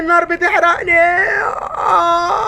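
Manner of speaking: high crying shout; burning scream. Acted. Ghost burning, high crying shout. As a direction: wail out in agony.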